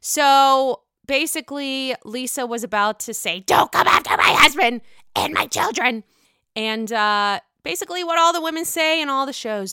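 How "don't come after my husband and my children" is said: louder, higher put-on voice; mock outraged warning. Lisa warns Sutton off the family, mock outrage. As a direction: punch out each word as an exaggerated threat.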